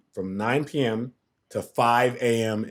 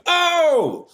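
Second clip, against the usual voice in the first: high, loud whoop of amazement; imitated astonished cry, voice shooting up; amazed "oh!"; 5 dB louder than usual. Retold reaction to amazing playback.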